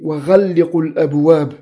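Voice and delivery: "وغلقوا" with a doubled l, wa-ghal-li-qu; تشديد اللام. Shown as a demonstration. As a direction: hold the l in "وغلقوا". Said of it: "بتشديد اللام". («وغلقوا» بلام مشددة)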